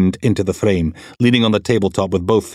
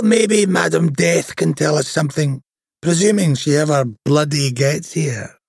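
higher, rough-humoured detective; raised-pitch voice for the crude inspector Steele; brash inspector voice; 5 semitones higher than usual. Acted. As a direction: play the crude, cheeky DI. Steele's voice in story.

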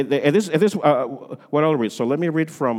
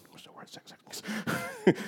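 whisper; 4 semitones higher, 12 dB quieter, much slower than usual. Acted. Stage whisper to congregation.

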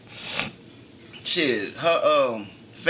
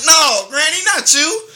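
mock horror; 13 semitones higher, 7 dB louder, slower than usual. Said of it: "I'm like".

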